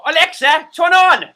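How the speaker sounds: loud, high-pitched bad British accent; hammed-up British accent shouted at a gadget; worst British accent. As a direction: belt out a terrible British accent. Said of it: "That's my worst British accent ever".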